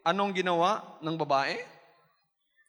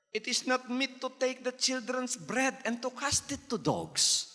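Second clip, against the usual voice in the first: slow, softer scripture read; solemn scripture reading; 2 semitones higher, 4 dB quieter, slower than usual. Acted. Preacher quoting Jesus's refusal, slow and solemn. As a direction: read Jesus's words slowly.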